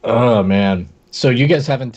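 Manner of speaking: ordinary delivery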